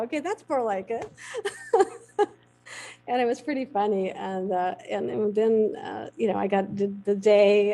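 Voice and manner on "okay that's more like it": laughing, smug. Real, not acted. Her triumphant reply to the cowboys.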